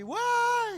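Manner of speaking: loud, very high hollered "wah"; long, high herding yell; cattle call. Shown as a demonstration. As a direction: holler as if calling cows in the forest. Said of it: "itu kayak panggil sapi".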